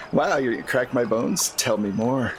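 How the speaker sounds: normal talking voice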